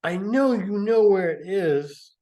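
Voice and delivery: high, loud, put-on drunk mother voice; shrill the demand like a drunk mom; hammed-up drunk mother demanding; mother demanding her bottle. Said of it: "Missus Haynes said".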